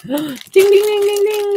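high, loud game-show chant; excited ding ding. Real, not acted. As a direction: ring it out like a winning bell.

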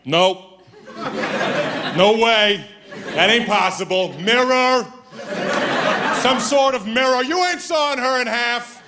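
scoffing closed-minded guy, dismissive; skeptical dude. Retold or imitated. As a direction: brush it all off like a know-it-all skeptic. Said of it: "just like".

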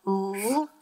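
"Lob" drawn out sound by sound, l, then ob. Shown as a demonstration. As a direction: sound out "Lob" as a lone syllable. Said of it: "is the first syllable".

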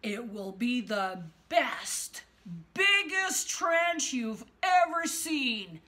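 slow, drawn-out bragging promise; boastful politician. Acted. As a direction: stretch every boast out grandly. Donald boasting, impression-like.

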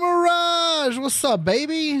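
high, bright excited hello; excited greeting. Real, not acted. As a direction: call out happily to a friend. Greeting a streamer friend.

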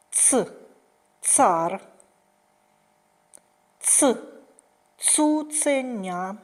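teacher modelling the "ц" sound; stressing ц. Shown as a demonstration. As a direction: model each word slowly, hitting the "ц". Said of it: "Називаємо слова разом зі мною".

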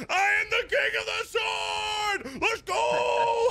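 high, crowing victory yell; exultant shout. Real, not acted. Duel winner celebrating his new title, exultant shout. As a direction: proclaim yourself champion at full pitch.

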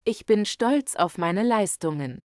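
ordinary delivery

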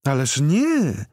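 high, slow, drawn-out woman's voice; blonde lady's reply. A narrator's reading. Organizer brushes off his question, in a slow high voice. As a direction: stretch it out sweetly.

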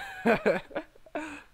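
high, loud burst of laughter; helpless laugh. Real, not acted. Player meeting the same opponent again, laughing helplessly. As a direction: crack up at your bad luck.

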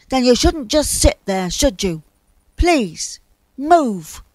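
loud, exasperated plea; irritated demand. A narrator's reading. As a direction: push them crossly to get going. Cross bear orders cloud to move.

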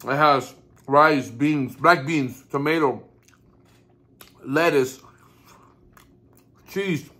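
talking while chewing food; muffled chewy speech; mouth full, chewing. Real, not acted. Seen 1: Chews with lips pressed and cheeks bulging after biting burrito while listing fillings.

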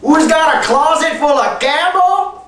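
normal talking voice

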